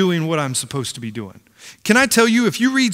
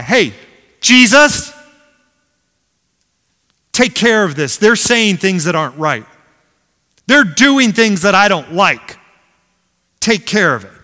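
complaining Pharisee; 3 semitones higher, 6 dB louder than usual. Acted.

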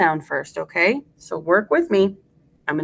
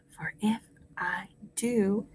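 whispered echo; 13 dB quieter than usual. Shown as a demonstration. Teacher leaves room for class to repeat, whispering. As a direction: whisper along word by word. Quiet echo of modelled line.

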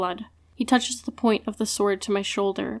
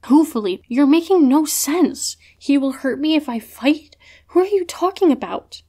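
higher and louder, sharp and exasperated; snapping; 6 semitones higher, 6 dB louder than usual. A narrator's reading. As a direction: snap back in frustration. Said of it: "I snap".

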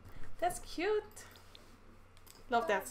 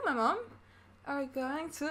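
usual speaking voice

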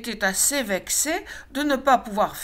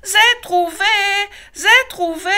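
excited cub; 12 semitones higher, 9 dB louder than usual. A narrator's reading. Loupiote runs to papa.

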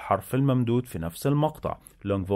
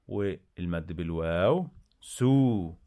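normal talking voice

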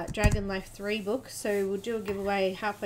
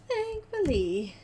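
THANKFULLY stretched out slow and high; playful echo; 7 semitones higher, slower than usual. Real, not acted. Relieved the missing order is coming, playful high echo on "Thankfully".

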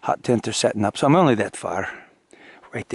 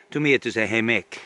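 ordinary talk

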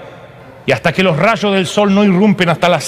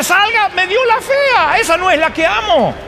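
outraged complaint; 11 semitones higher than usual. Acted. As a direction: wail at being cheated. Jacob complains to father-in-law.

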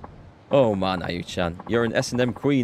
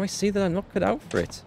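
high timid voice; 11 semitones higher than usual. Acted. Character's timid confession, high voice.

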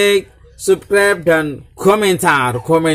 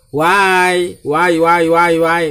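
louder, higher chant of why; hyped-up why; 3 semitones higher, 4 dB louder, much slower than usual. Acted. Vlogger hyping his viewers with repeated why questions. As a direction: fire the question at viewers like a hype host.

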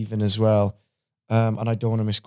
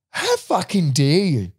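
higher, outraged retold scolding; indignant telling-off; 6 semitones higher than usual. Actor friend defending him to rude girl, indignant. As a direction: imitate a friend's affronted rebuke, starting slow. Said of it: "I remember him going to her".

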